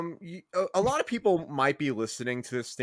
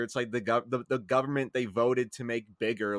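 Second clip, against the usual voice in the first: ordinary talk, nothing marked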